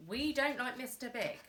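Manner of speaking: plain reading voice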